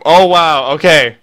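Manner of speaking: normal talking voice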